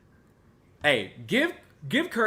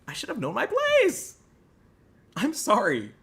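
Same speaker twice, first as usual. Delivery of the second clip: high, pitched-up mock grovel; mock contrition; 9 semitones higher, 3 dB louder than usual. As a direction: ham up the humble remorse. Mock contrite self-abasement.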